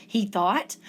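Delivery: plain reading voice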